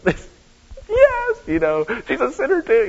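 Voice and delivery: high, gleeful mock cheer; mock triumph. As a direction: crow that she sins after all. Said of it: "We're like".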